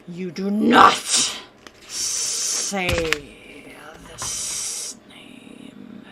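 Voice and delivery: furious growl into a hiss; threaten, then drop to a hiss; angry lord. Acted. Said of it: "eyes flash red as he slams his fist".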